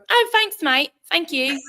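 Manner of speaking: a cheerful Cockney thank-you, pitched high; Cockney accent. Retold or imitated. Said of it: "I just talk my Cockney accent all the time".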